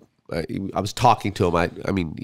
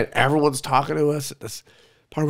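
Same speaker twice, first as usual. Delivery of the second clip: ordinary talk, nothing marked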